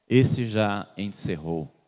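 ordinary talk, nothing marked

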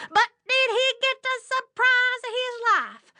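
high-pitched, punchy storyteller exclamation; mock astonishment. A narrator's reading. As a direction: ring it out high on one sustained pitch. Storyteller builds to big surprise.

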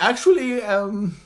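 high mock voice of a hesitant speaker; exaggerated imitation. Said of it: "he doesn't sound like that".

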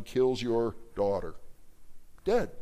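ordinary talk, nothing marked

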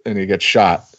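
ordinary delivery

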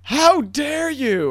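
high, very slow, theatrical how-dare-you; high slow indignant gasp of a line; mock outrage. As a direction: ham up the offended hero. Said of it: "But he goes".

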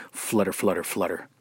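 soft, low mock fairy-tale sparkle; mock magical flutter. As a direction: sigh it out like a sarcastic spell. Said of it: "it sounds so magical".

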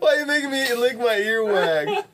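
high, squealed through laughter; real laughing protest. Real, not acted. Player complaining to friend about a gross dare, laughing. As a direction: protest while cracking up, voice shooting up.

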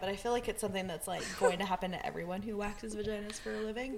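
ordinary talk

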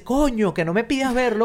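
high, exasperated street Venezuelan; slipping into Venezuelan slang. Retold or imitated. Doctor refusing Diego's request, high exasperated Venezuelan slang. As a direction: let it burst out of the doctor. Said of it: "y le sale así".

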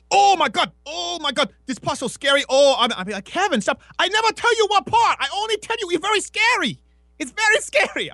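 very high, far-off put-on friend voice; mock frightened gush. Kevin insisting the scene is scary, very high mock voice.

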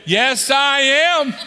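loud, high, slow, drawn-out yes; stretched joyful cry; eager shout. Retold or imitated. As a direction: shout it out gleefully at the counter. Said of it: "My wife was saying".

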